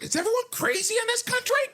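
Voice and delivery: very high, exasperated disbelief; incredulous squeal. Retold or imitated. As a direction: ask it in shrill disbelief at the whole country.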